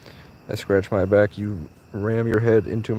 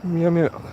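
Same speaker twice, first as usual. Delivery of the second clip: higher cat noises made at a cat; higher meows aimed at a stray cat; meowing at a cat; 6 semitones higher than usual. Pretend. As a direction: coax the cat with a meow.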